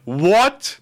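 high, rising, drawn-out question; imitated disbelief. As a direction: act out someone's shocked reaction. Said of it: "gonna be like".

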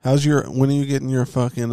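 ordinary talk

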